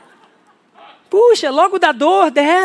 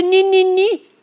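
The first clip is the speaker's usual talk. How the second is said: mock baby talk; 5 semitones higher, much slower than usual. Shown as a demonstration. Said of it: "ursinho de pelúcia".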